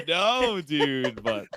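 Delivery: ordinary delivery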